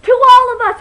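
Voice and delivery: high, very loud imitated battle cry; shouted cheer. Imitating Sam springing on the drama teacher, shouted. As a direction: yell the toast in ambush. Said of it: "and she goes".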